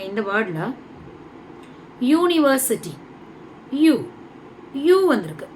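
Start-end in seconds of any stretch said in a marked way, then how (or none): 2.0-5.1 "யூனிவர்சிட்டி" with a high, stressed "yoo", then "யூ" alone; opening "yoo" sound. Shown as a demonstration. Teacher showing which sound starts university, stressed "yoo". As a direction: lift and isolate the "யூ" of "யூனிவர்சிட்டி". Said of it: "யூங்கிற sound".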